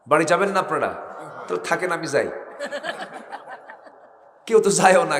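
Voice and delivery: ordinary delivery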